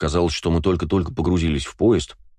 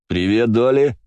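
loud, bright hearty hello; cheerful greeting; 5 semitones higher, 4 dB louder, slower than usual. Acted. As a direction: greet her with boisterous joy. Cheerful Slava greets Kristina.

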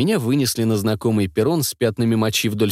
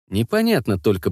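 ordinary delivery

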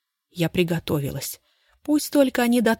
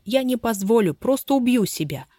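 plain reading voice

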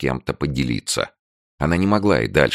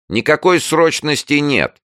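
shouting from afar; 5 dB louder than usual. A narrator's reading. Manager shouting from corridor.

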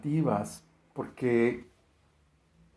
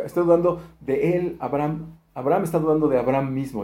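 ordinary talk

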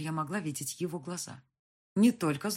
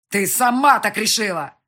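loud, raised angry bark; barked retort; 5 semitones higher, 16 dB louder than usual. A narrator's reading. Husband throwing the blame back, barking. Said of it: "гаркнул он в ответ".

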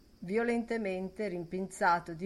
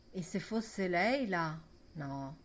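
usual speaking voice

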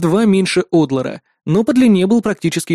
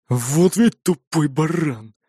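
grumbling; much slower than usual. A narrator's reading. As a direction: mutter it in disgust. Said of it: "в сердцах проворчал Гуннар". (ворчит в сердцах)

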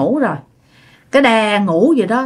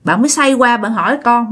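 usual speaking voice

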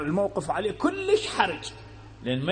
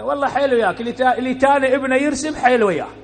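sarcastic retort; 5 semitones higher, 6 dB louder, faster than usual. Acted.

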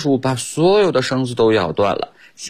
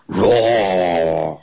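mock lion roar; 3 semitones lower than usual. Teacher playing the trapped lion for children, lightly voiced roar. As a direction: roar like a storybook lion, held back. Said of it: "狮子使劲儿地大声叫喊着".

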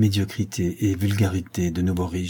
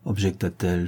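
usual speaking voice

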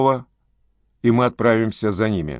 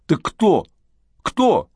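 desperate demand; 8 semitones higher, much slower than usual. Acted. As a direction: pitch shooting up in urgency.

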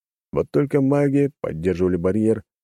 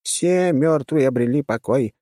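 high-pitched character voice; 7 semitones higher, slower than usual. Acted.

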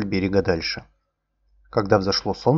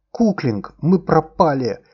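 loud, higher alarmed cry; exclaiming; 7 semitones higher, 5 dB louder, much slower than usual. Acted. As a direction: blurt it out in alarm. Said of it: "воскликнул я".

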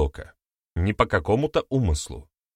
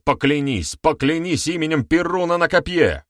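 raised, higher voice demanding an oath; heated demand; 7 semitones higher, 4 dB louder than usual. A narrator's reading. Accuser challenges the veteran to swear by Perun, heated demand. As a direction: press hard for the oath.

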